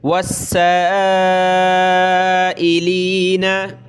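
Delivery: वस्साइलीना with the सीन doubled hard and the vowel drawn long; slow chanted वस्साइलीना with a hard doubled सीन; stretched stressed sin. Shown as a demonstration. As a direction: lean on the सीन in वस्साइलीना and hold the madd. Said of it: "मद करेंगे और इसको सख्त करेंगे सीन को".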